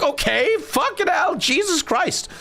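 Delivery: high, disbelieving okay and curse; a kid thrown off by the warning; stunned kid. Retold or imitated. Boy hears the police officer's warning, high disbelief.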